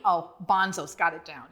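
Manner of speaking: ordinary delivery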